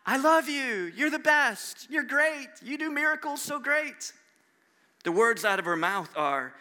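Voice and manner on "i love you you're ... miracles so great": high-pitched mock adoration; gushing praise. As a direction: gush in a raised, put-on voice. Imagined praise contrasted with Mary's words.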